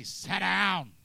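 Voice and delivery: loud congregation call; loud group response sit down. Real, not acted. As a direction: shout it back to the preacher. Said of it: "Everybody say".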